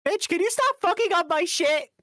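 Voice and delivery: shrill-high fake indignant yell; high mock outrage. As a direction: squeal it like you're offended. Mock outrage at friend's drawing.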